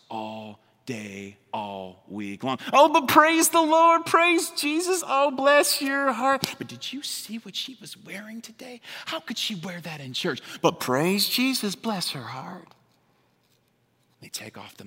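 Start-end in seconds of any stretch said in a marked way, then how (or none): 2.7-6.4 high, slow, syrupy mock praise; mock pious churchgoer. Preacher mimicking a fake pious churchgoer at church, high and slow. Mocking fake piety after fighting spouse. 6.6-10.4 high, hushed catty gossip; mock church gossip. Preacher mimicking a gossiping churchgoer, hushed and catty. 10.6-12.7 slow, sugary fake blessing; mock sweet piety. Preacher mimicking a gossip faking a blessing, slow and sugary.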